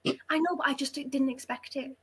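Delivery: usual speaking voice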